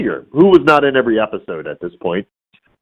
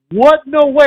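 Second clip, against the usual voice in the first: high-pitched, louder mock disbelief; astonished; 4 dB louder than usual. Cosplayer meeting the actor he dresses as, astonished. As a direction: squeal it in amazed disbelief. Acts out cosplayer's amazed reaction.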